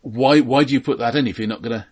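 ordinary delivery

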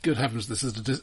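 ordinary talk

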